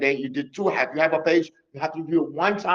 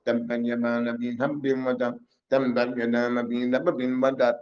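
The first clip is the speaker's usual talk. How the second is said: fast, run-together Arabic recital; rushed recitation; 5 semitones lower, much faster than usual. Shown as a demonstration. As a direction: rattle the verse off in a hurried monotone. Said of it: "You're not gonna go".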